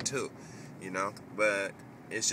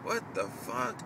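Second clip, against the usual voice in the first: disbelief; 4 semitones higher, slower than usual. Retold or imitated. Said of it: "you're like".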